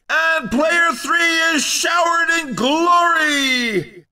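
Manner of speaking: high, ringing announcer cry; glorify the winner; excited, grandiose victory call; triumphant winner call. Acted. Announcer calls the race winner.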